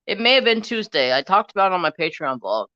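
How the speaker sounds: usual speaking voice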